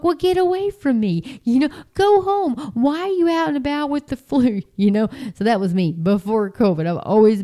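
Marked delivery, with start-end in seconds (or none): 0.0-4.6 exasperated. Retold or imitated. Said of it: "I'm like".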